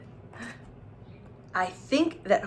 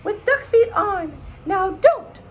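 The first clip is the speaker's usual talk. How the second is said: high, loud scolding mom voice; mother scolding; 13 semitones higher, 6 dB louder than usual. Acted. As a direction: shriek it like an angry mother. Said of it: "And she would say".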